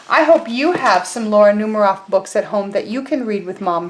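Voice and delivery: ordinary talk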